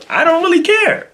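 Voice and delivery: very high, singsong brush-off; mock indifference. Acted dismissal of her excuses.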